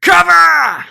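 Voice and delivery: very high, very loud bellow; yell. Acted. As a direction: scream the order at full force. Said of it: "And he yells".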